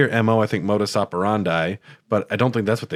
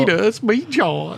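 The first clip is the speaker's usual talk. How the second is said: nerdy John voice; 12 semitones higher than usual. Acted. Imitating John's voice.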